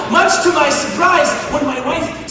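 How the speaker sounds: usual speaking voice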